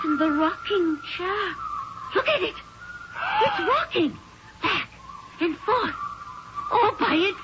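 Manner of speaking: very high, tearful fright; terrified. Acted. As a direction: point it out in broken, horrified pieces. Watches chair rock by itself.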